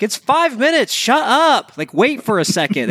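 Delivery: exasperated retort. Retold or imitated. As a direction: tell them to get over it, exasperated. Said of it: "I'm like".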